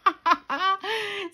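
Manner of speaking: amused, tickled cackle; self-mocking laugh. Real, not acted. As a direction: laugh at your own bad accent. Laughs at her English accent.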